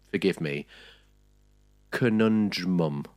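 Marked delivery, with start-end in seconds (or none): 1.9-3.1 slow, drawn-out title reveal; segment title announcement. Shown as a demonstration. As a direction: announce the segment name slowly. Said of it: "we do have a new section called".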